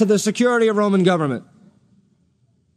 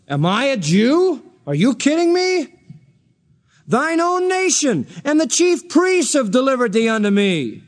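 incredulous retort; 5 semitones higher than usual. Retold or imitated. Pilate retorts to Jesus.

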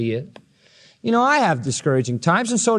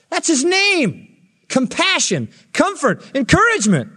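much higher, mounting fervour; preaching climax; 11 semitones higher than usual. Acted. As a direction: build to an exultant cry.

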